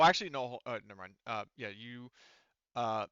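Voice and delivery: ordinary talk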